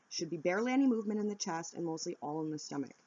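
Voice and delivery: ordinary talk, nothing marked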